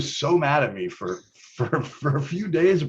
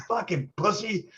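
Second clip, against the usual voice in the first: sneering insult; 10 semitones higher, 4 dB quieter than usual. Retold or imitated. Chris insulting the big manager.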